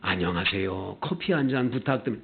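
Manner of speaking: soft, low, courteous request; kind, polite order. Acted. Friendly customer greets café staff and orders, kindly. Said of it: "다 친절해".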